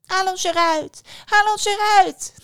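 high, loud, shrieky little callers; little apple voices. Acted. Apples suddenly call to the dazed daughter, high little voices. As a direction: squeal for help as tiny apples. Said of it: "hoorde ze plots stemmetjes".

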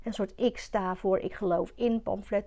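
ordinary talk

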